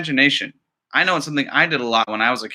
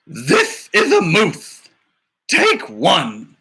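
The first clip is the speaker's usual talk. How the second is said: slow, louder clapboard call of the title; slate announcer; 3 semitones higher, 4 dB louder, much slower than usual. Acted. Teacher reading a picture book as the film slate, slow announcer.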